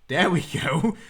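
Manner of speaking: corrected word called out loud; triumphant shout of the right word. Real, not acted.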